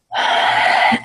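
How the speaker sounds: long, audible breathing sigh; deep breath. Shown as a demonstration. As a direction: let out a deep, demonstrative breath. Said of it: "you take a deep breath".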